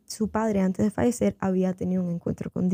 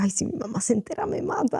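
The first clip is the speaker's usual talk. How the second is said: light retold panic, pitch leaping at the end; mock dread; 3 semitones higher than usual. Young woman imagining her mother finding out, mock dread. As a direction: play at being scared of mom, voice jumping up.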